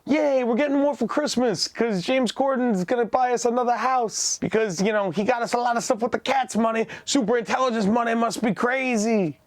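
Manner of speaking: high, excited put-on family member voice; mock giddy relative. James Corden's relative gushing about Christmas money, high excited mock voice. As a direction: gush like a giddy relative expecting gifts.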